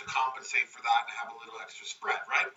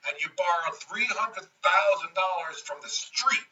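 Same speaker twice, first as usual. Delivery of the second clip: ordinary talk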